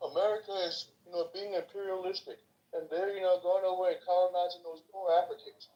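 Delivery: put-on voice of indignant objectors; mocking objectors.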